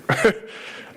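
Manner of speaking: quick breathy laugh; a rueful little laugh; short laugh. Real, not acted. Laughing at his own joke about the judge.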